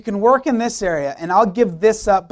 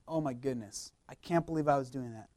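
very soft, low mock confession; imitated hushed remorse; 7 semitones lower, 15 dB quieter than usual. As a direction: imitate someone quietly stunned by their own wrongdoing. Voices someone confronted with sin.

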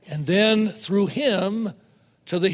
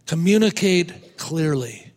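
usual speaking voice

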